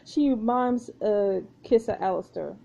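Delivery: ordinary talk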